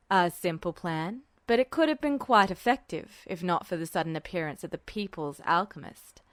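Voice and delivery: a composed colonel brushing off the question; Mustang, cool and dry. A narrator's reading. Mustang's lines in the story.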